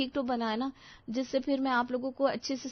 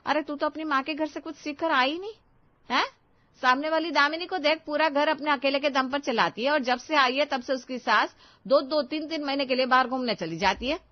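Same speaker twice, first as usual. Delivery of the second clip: higher, louder nagging rebuke; scolding mother-in-law; 5 semitones higher, 5 dB louder than usual. Acted. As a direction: tear into the bride, comparing her to Damini. Mother-in-law scolds new bride.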